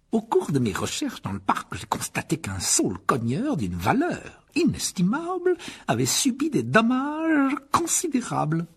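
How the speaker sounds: aigu, lent, accusateur; Rogue accusateur. A narrator's reading. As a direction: accuser avec emphase. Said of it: "poursuivit Rogue". (professeur accusateur)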